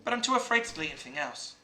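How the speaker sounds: lightly voiced eerie game girl. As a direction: confide it quietly as the game character. Reads game character's lines aloud.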